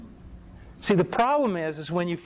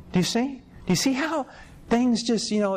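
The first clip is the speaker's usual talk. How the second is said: pressing the point; 8 semitones higher than usual. Real, not acted. Preacher presses the audience.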